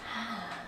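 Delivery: long, soft exhale; releasing sigh. Real, not acted. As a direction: sigh out into the stretch. Breathes out on sore spot.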